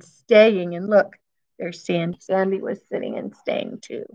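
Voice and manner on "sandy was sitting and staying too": ordinary delivery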